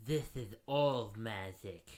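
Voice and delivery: put-on slow ominous "This is old magic"; dramatic. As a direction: intone "This is old magic" slowly and darkly. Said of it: "That sounded great with my lisp".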